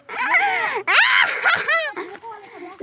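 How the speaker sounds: high shrieking laugh; excited laughing shriek. Real, not acted. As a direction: squeal with delighted laughter. Excited greeting, laughing shout.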